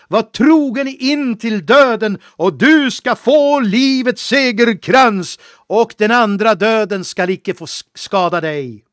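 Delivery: loud, high, impassioned sermon; preaching at full force. Acted. Preacher loudly urging believers to stay faithful unto death. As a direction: thunder it like a fiery revival preacher. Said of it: "jag hoppas jag inte har varit för hård".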